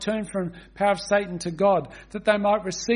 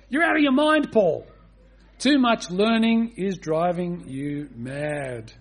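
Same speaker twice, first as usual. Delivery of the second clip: outraged interruption; 3 dB louder, slower than usual. Acted. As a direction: shout the accusation, then draw it out. Acted outburst of Festus from the reading.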